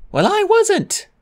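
put-on very high, loud young dragon voice; Clay retorting. A narrator's reading. Clay answering Fjord, high. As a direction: blurt it out high. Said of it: "Clay said".

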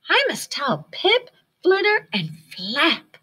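high, eager little bat voice; Stellaluna voice. A narrator's reading. Stellaluna eager to tell her friends, high voice. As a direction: sound like an excited young bat.

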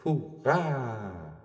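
high cheering shout; the nisse boy cheering as he floats away; shouting hurray. Acted. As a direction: cheer it out as you escape. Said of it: "svingede sin lille tophue og råbte".

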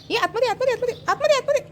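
very high, repeated sing-song call. Real, not acted. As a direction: chivvy a little one in with a high voice. Urging toddler to come inside.

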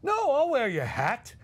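slow, high, sarcastic give-in; grudging fury. Retold or imitated. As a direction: concede through clenched anger. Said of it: "he was furious".

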